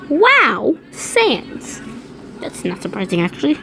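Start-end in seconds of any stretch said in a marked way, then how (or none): 0.1-1.8 high breathy Wow then Sans; mock surprised squeal. Real, not acted. Reader reacting to Sans asleep, high squeal of surprise. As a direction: squeal in amused disbelief.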